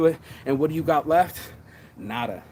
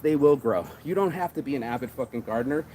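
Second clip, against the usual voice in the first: usual speaking voice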